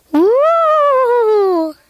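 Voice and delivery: high loud ghostly woo; ghost holler. Acted. Ghost hollering at the boy upstairs, loud and high. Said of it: "one of 'em hollered out".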